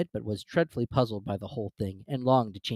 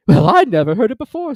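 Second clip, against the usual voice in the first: high, loud, indignant turtle; a much higher, louder huffing creature; Mock Turtle voice; 11 semitones higher, 13 dB louder than usual. A narrator's reading. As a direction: scoff at the verse.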